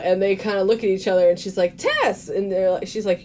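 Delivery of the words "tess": high-pitched startled recognition; retold surprise. Retelling Eva recognizing Tess, surprised. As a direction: blurt the name high, acting surprised. Acted shock at meeting someone known.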